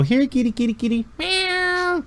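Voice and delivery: high sweet "here kitty" call; cooing to cats. Acted. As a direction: coax the cats in a high coo. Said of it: "you hear him go".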